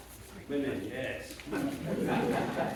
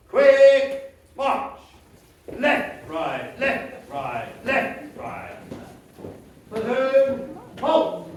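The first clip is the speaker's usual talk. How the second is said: shouted marching commands; 5 semitones higher, 7 dB louder, much slower than usual. Acted. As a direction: bark left right loud.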